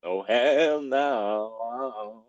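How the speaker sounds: drawn-out hammed-up hell no; drawn-out mock outrage. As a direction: stretch out each word like a dramatic diva. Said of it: "Speed air".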